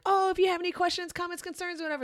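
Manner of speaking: bright sing-song announcer impression; chirpy host voice. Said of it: "us being like".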